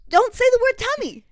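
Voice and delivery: loud high protest; exasperated. Real, not acted. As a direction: cut her off in horror.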